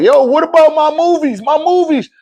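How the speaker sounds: high-pitched mock pestering; clamoring customers. Retold customers clamoring for movies.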